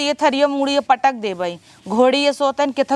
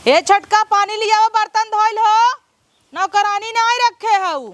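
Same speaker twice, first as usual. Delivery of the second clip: raised, high-pitched telling-off; scolding order; 7 semitones higher than usual. Acted. Elder in-law ordering the younger to wash dishes, scolding. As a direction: order them about in a high, annoyed voice.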